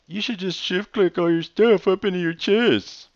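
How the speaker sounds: mocking advice voice. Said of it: "tell me".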